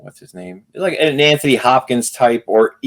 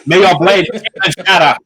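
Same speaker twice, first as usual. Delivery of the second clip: raised, playful ritual proclamation; mock Dune ritual; 5 semitones higher than usual. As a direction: pronounce it as a hammed-up film curse. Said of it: "I do the".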